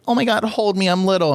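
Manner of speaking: high, squeaky plea to be held; a big guy hamming up being tiny and needy; mock helpless little one. Joking to Michael, mock squeaky "hold me" voice. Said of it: "'cause I'm like".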